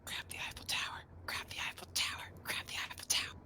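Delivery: unvoiced whisper to a teammate. Real, not acted. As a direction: whisper it urgently. Player whispering suggestion repeatedly.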